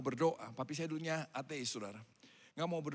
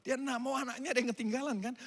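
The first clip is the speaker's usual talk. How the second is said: usual speaking voice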